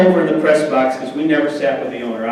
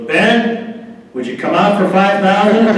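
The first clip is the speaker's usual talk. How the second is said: higher, louder sly bargaining offer; sly wink; 5 semitones higher, 3 dB louder than usual. Retold or imitated. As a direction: say it like you're sharing a wink. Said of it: "looks at me and winks".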